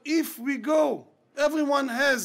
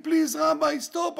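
retold plea, pitched higher; begging; 3 semitones higher, 3 dB louder than usual. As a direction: beg him urgently to stop. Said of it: "I begged him".

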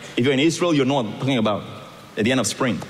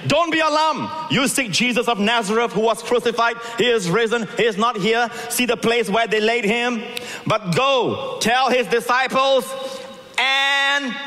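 high, proclaiming angel; 9 semitones higher than usual. Acted. Angel's message at the tomb.

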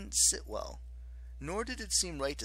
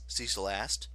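plain reading voice

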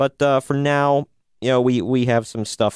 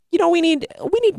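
high squeal; faster than usual. Acted. Hyping need for subscribers.

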